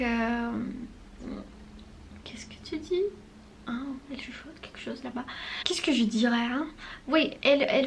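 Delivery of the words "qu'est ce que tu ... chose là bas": hushed unvoiced aside to someone nearby; real whisper, turned away from the mic. Real, not acted. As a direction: drop to a whisper for a quick side remark.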